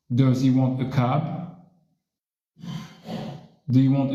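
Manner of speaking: teacher reading sentences for a listening drill; reading practice sentences. Shown as a demonstration. Reads ten practice sentences.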